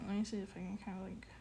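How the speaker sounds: soft whisper to herself; barely voiced, thinking aloud. Real, not acted. Muttering to herself while working.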